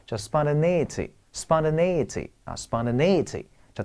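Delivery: spontaneity, spontaneity said slowly with the stress on TA; slow drill of spontaneity, leaning on the stressed TA syllable. Shown as a demonstration. Teacher drilling students on where spontaneity takes its stress. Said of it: "它的难度在于重音位置".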